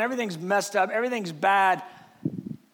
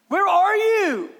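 higher, louder, drawn-out cry; desperate prayer; 9 semitones higher, 6 dB louder, slower than usual. Acted. Believer praying for a breakthrough, desperate and demanding. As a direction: plead impatiently with God.

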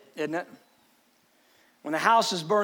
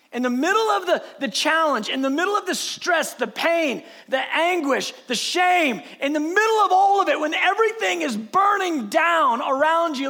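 building, raised sermon cadence; impassioned preaching; 4 semitones higher, 4 dB louder than usual. Acted. Preacher urging the congregation to seek God in crisis, impassioned. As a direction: pile up the phrases toward a forceful peak.

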